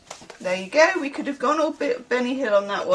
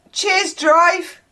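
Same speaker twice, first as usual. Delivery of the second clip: loud, high Bristol call-out; Bristol accent; 9 semitones higher, 6 dB louder than usual. Shown as a demonstration. Passenger thanking the bus driver, Bristol accent. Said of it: "they'll say it in that accent".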